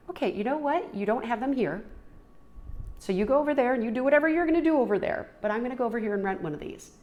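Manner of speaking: exasperated fast comeback; defiant retort. Retold or imitated. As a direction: fire it back, fed up. She answers back in quarrel.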